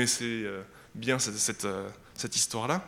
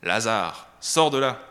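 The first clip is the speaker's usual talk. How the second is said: calling Lazarus out of the tomb, loud and commanding; Jesus crying out to dead Lazarus in a strong voice; 6 dB louder, slower than usual. Acted. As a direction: throw the voice out strong, a command to come out. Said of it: "il cria d'une voix forte".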